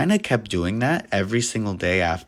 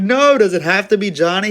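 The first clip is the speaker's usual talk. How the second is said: high, loud pleading whine; whiny plea; 10 semitones higher, 7 dB louder than usual. Retold or imitated. Co-manager begging the boss not to fire Johnny, high whine. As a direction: beg to save your buddy. Pleading to spare his friend.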